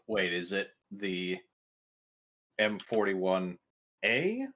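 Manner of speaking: normal talking voice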